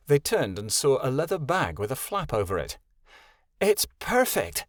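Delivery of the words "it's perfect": exclaiming. Acted. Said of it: "Kenai exclaimed".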